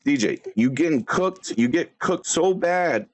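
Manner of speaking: ordinary delivery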